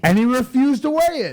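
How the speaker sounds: loud, high-pitched exclamation of disbelief; indignant. Real, not acted. Telling the host about the refused weighing, loudly indignant. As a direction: voice shoots up in outrage.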